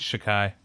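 "Chikai" as shuh-KAI, ending like "eye"; the way they say it. Shown as a demonstration. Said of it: "That's how they say it".

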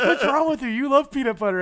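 high, loud, laughing voice; laughing through the quote. Retold or imitated. Friend retelling the show, laughing through the owner's words. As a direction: crack up while quoting. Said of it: "he's like".

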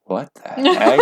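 high-pitched mock disbelief; comic, squeaky double-take; baffled reaction. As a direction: imitate someone totally taken aback. Cops' baffled reaction, acted.

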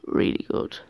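a secret little whisper of praise. Shown as a demonstration. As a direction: whisper it, unvoiced. Repeats praise, then whispers it.

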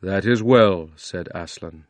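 deep, solemn lion king speaking gravely; Aslan. Acted. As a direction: speak as the great lion, slow and grand. Aslan's voice in the story.

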